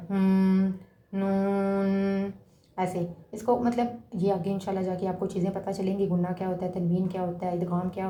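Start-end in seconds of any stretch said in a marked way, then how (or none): 0.1-2.3 نون drawn out long through the nose; nasal ghunna demo. Shown as a demonstration. Teacher showing how not to read نون here, nasal hum. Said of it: "جیسے ہم غنہ کرتے ہیں".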